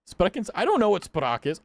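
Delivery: ordinary delivery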